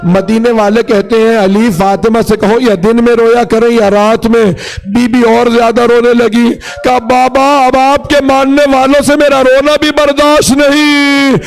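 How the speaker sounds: high, wailing majlis lament; lamenting sermon. Acted.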